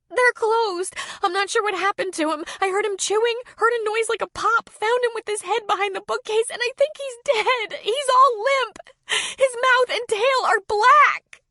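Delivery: put-on high frantic child voice; panicked child. A narrator's reading. Girl telling Kelly her puppy seems dead, frantic. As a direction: gasp it out in panic.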